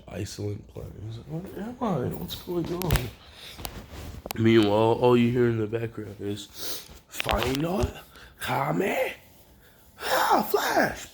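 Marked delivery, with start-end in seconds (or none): 1.3-3.1 high-pitched, hammed-up confusion; bewildered questioning. Deku landing on a strange planet, bewildered. 7.1-11.1 mock attack cry. Fighters heard in the background, mock battle cry.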